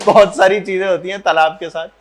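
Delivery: words broken by real chuckles; barely holding in laughter at your own joke; laughing while talking. Real, not acted. Teacher jokes about buffaloes in a pond, laughing through it.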